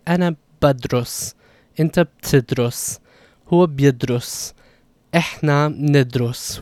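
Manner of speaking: slow, careful Levantine Arabic, each verb spelled out by sound; 'Ana badrus', 'Inta btidrus', 'Huwa bidrus', 'Ehna nidrus' said slowly with the b-prefix clearly sounded; slow Arabic conjugation drill. Shown as a demonstration. Teacher showing students the present tense, said slowly and carefully. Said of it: "So we'll say".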